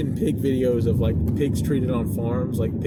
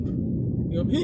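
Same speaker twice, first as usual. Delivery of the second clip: ordinary delivery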